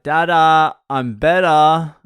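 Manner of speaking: put-on loud, high drawn-out kid's call; calling out to dad. Son calls to dad from bed, loud pleading call. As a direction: imitate a child hollering for his father.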